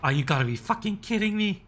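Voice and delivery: loud exasperated disbelief; angry outburst. Real, not acted. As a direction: blurt it out, fed up. Sudden frustration at the game.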